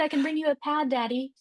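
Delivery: put-on high, sugary child voice offering help; sweet little girl. Daughter offering her dad a pad, high and sweet. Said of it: "He's like".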